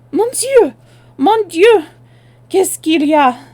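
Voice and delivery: high, loud French cry of alarm. Acted. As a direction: shriek it out in French. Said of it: "with a loud cry".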